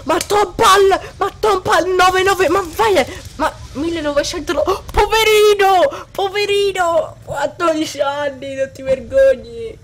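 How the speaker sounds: mocking. Real, not acted. Mocking opponent.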